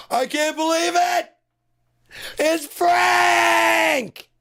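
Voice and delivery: very high, loud thrilled shouts; reuniting with a friend, screaming with joy; overjoyed greeting. Shown as a demonstration. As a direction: greet him like a long-lost buddy. Mock-joyful friend greetings.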